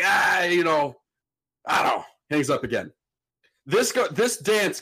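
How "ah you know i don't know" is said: put-on drunk shout. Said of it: "He's like".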